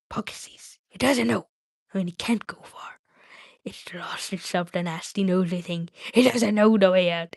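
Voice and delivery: hissy creature voice, part whispered; Gollum, arguing with himself. Acted. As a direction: fret and reason aloud as Gollum.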